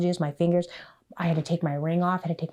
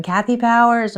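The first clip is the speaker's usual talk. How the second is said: called out name; 7 semitones higher, 11 dB louder than usual. Retold or imitated. Name called again at finish.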